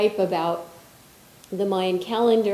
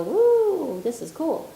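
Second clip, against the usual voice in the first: mock giddy woo; 7 semitones higher, slower than usual. Mocking a giddy person.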